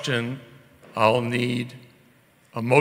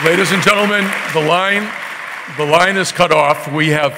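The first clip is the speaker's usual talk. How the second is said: raised voice calling the room; loud; 4 semitones higher, 7 dB louder than usual. Real, not acted. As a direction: call out over the crowd. Moderator quieting the crowd.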